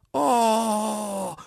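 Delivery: high, drawn-out "oh"; tiny creatures' long high exclamation; chorus of dots. A narrator's reading. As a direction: cry out in a high little put-on voice. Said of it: "riefen die Punkte im Chor".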